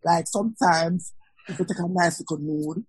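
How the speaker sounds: ordinary delivery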